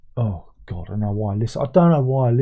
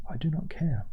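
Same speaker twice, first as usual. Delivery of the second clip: "I do not care" whispered, unvoiced and much softer; 4 semitones higher, 9 dB quieter than usual. To his viewers, repeating his dismissal in a whisper.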